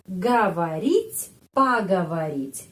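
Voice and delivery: говорить and поговорить slow, o sounds like a; teacher modelling говорить; slow careful Russian. Shown as a demonstration. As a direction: model the reduced o slowly. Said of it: "huruf O dibaca A".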